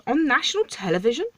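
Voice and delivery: scandalised, as if unable to believe it; outraged king. Acted. Outraged king scolds the dwarf.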